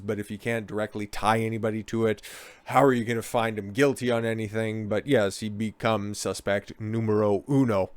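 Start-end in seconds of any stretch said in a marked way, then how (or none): none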